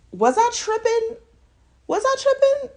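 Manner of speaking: high squeaky disbelieving ask; sky-high pitch, asking the viewers; incredulous question. Real, not acted. Asks viewers, mock disbelief.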